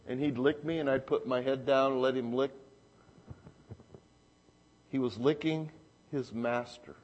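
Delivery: usual speaking voice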